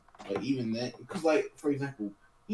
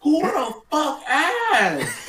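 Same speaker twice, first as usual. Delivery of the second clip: real laughing shout, much higher and louder, slow; 14 semitones higher, 7 dB louder, much slower than usual. Real, not acted. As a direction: yell it through laughter. Friend announces toilet flush.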